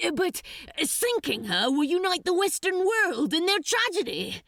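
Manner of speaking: high, loud, fast pleading; desperate. Acted. Crowley desperately pleading with the archdemon, high. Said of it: "Crowley tried again desperately".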